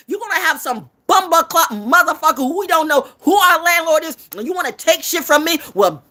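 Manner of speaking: loud, high-pitched defiant tirade; angry rant. Real, not acted. Telling off rivals who cross her, real angry rant. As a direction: tear into them at the top of your voice.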